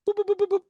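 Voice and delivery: string of high-pitched boops; rapid high boops. Retold or imitated. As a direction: imitate a quick electronic-style "boop" run. Imitated sound effect in story.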